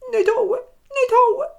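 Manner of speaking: high, slow, sobbing begging; terrified little dwarf wailing no beating; crying panicked plea. Acted. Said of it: "sagt der Zwerg".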